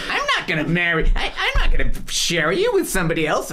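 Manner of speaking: high, loud mock protest with a laugh; mock protesting woman. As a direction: play the indignant convert, laughing. Women's reply, acted.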